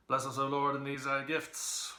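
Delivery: mock blessing. A narrator's reading. Mock grace before meal.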